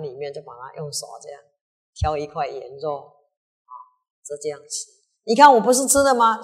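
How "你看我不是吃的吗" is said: loud, high, smug challenge; gloating. Acted. Retainer gloating at the king, loud smug challenge. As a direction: show off that you ate it anyway.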